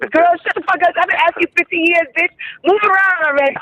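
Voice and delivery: high mock girly voice for the friend; mimicking him. Retelling the friend's reply, high mock sassy voice. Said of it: "He's like".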